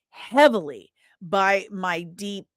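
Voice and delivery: ordinary talk, nothing marked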